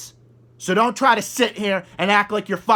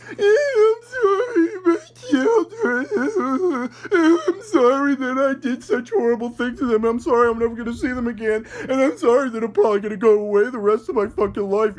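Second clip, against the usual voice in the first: mock crying apology; 5 semitones higher than usual. Commentator mocking a mother's tearful apology, mock sobbing. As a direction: mimic a weeping mother, hammed-up and badly faked.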